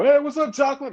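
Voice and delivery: high calling-out greeting; imitated fans. People greeting the singer everywhere, imitated fans. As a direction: hail the singer from across the street. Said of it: "everywhere I go".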